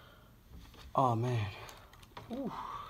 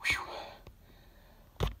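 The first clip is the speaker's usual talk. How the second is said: long unvoiced exhale; out of breath from blowing balloons; breathless whew; 14 dB quieter than usual. Real, not acted. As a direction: blow out air to catch breath. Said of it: "Trying to catch my breath".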